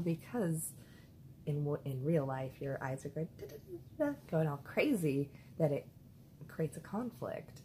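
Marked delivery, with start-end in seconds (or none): 3.4-4.2 high quick da-da-da; darting eye noise. Retold or imitated. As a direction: mimic eyes flicking fast. Eyes darting in dream.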